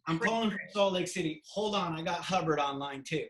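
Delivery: a hammed-up phone call from Jesus; mock Jesus on the phone. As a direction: deliver the punchline big. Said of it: "retelling Jesus joke".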